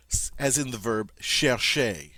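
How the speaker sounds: chercher said shair-shay, both ch as sh; French chercher, sh and sh. Shown as a demonstration. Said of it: "Notice that sh sound".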